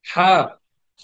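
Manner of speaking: normal talking voice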